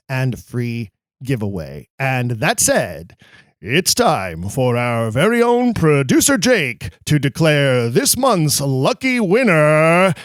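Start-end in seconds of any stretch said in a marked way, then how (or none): 3.6-10.1 booming radio announcer, louder; big hammy on-air announcer delivery. Acted. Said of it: "That's my radio announcer voice".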